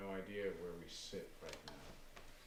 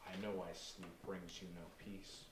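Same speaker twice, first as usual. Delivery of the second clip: quiet menace; 7 dB quieter than usual. Acted. Aaron voice from darkness.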